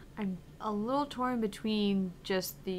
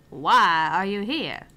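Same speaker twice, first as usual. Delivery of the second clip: louder, lower, slow; slow, weighty demand; pressing interviewer; 3 semitones lower, 9 dB louder, slower than usual. Acted. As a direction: press him for the real answer. Repeated question, pressing.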